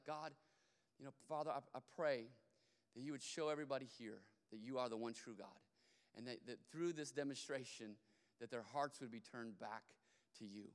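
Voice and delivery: low, soft prayer with pauses; quietly petition God, pausing between phrases; praying. Retold or imitated. Elijah prays before the people, quiet and earnest. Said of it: "he begins to pray to the Lord".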